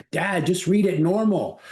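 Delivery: high-pitched mock kid complaint; high, exasperated young boy impression; imitating his boys. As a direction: plead with dad in a put-on child's voice. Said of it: "my boys are saying".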